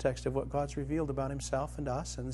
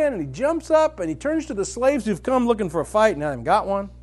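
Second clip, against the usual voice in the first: high, loud, driving sermon delivery; impassioned preaching; 7 semitones higher, 9 dB louder than usual. Acted. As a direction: preach it full tilt to the crowd. Preaching at full force.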